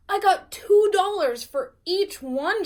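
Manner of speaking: high, loud little bragger; bragging Muffy. Acted. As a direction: show off the money proudly. Muffy boasting.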